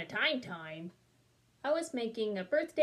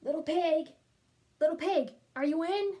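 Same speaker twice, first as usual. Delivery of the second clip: higher-pitched call to a neighbor; 5 semitones higher, 4 dB louder than usual. Acted. Wolf at the pig's door, calling out. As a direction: call out to see if he's home. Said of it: "so I called".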